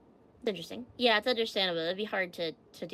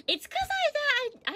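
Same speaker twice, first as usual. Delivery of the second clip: flustered; slower than usual. Real, not acted. Flustered about the wrong day.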